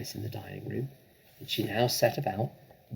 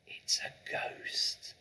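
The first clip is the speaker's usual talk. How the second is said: slow breathy whisper; ghostly whisper; 10 dB quieter, much slower than usual. Acted. Matilda pretending to be scared, in a slow whisper. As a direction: hush it out like a secret.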